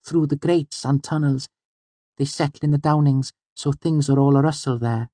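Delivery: put-on high-pitched girl character, chatting; high, conversational storybook girl; chatty Auri. A narrator's reading. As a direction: voice a girl sharing news, pitched well up.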